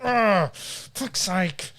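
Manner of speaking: long groan, then slow curse; imitated toddler exasperation. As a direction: groan like a fed-up little kid. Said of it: "if he makes a mistake".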